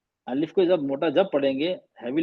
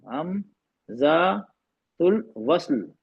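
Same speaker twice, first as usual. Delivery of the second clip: हमजातुल वस्ल stretched syllable by syllable; very slow drawn-out term; much slower than usual. Shown as a demonstration. Teacher to students, repeating the term very slowly. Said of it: "इसका नाम है हमजातुल वस्ल".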